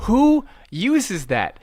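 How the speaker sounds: high, slow and incredulous; exasperated disbelief. Retold or imitated. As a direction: drag it out in disbelief. Exasperated question.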